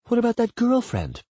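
high-pitched, probing question; questioning woman. Acted. Woman asks about partner's past, probing. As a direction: probe gently in a high voice.

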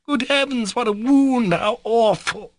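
very high, loud shriek of dismay; theatrical high-pitched horror; horrified cry. Acted. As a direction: cry out aghast, dragging the last words. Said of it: "screamed and shut her eyes".

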